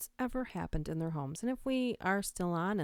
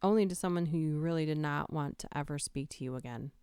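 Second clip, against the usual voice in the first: normal talking voice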